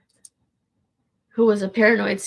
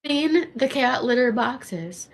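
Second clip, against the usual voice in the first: slow sing-song; 4 semitones higher, much slower than usual. Shown as a demonstration. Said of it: "repeating a chore line".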